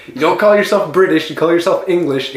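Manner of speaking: ordinary talk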